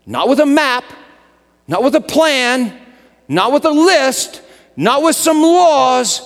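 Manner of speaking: high, loud sermon build; rising pulpit cadence; preaching. Acted. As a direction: hammer each "not" home to the congregation.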